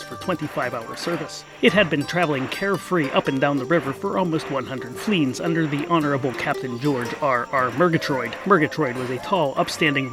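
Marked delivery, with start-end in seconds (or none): none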